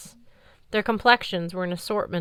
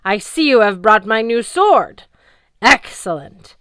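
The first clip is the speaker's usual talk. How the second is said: loud gloat; 3 semitones higher, 11 dB louder than usual. Acted. Cert gloating, booming.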